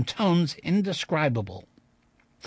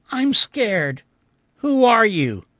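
put-on high, louder frightened child voice; scared little girl; 7 semitones higher, 7 dB louder, slower than usual. A narrator's reading. Frightened ghost child speaks.

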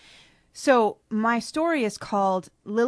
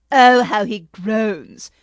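louder, slow gloating cry; gleeful cry; 12 dB louder, much slower than usual. Acted. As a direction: savor his pain gleefully. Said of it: "cried ... with glee".

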